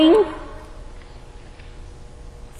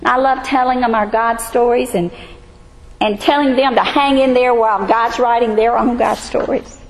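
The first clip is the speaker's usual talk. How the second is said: ordinary delivery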